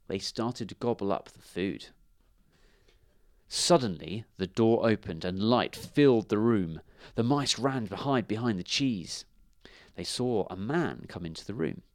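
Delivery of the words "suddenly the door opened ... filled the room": plain reading voice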